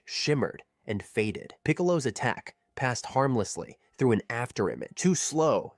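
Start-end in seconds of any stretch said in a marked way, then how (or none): 5.0-5.7 high mocking taunt. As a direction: toss it off like a bully. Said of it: "Zarbon taunted".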